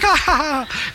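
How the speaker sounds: high mock laugh ha ha ha; imitating her laughter. Pastor imitates Brenda laughing again, high mock laugh. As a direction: toss off a high light cackle. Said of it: "she's like".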